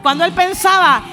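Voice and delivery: loud, rising sermon voice; preaching at full force. Acted. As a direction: preach it out to the congregation. (predicación a todo pulmón, fuerte y elevada)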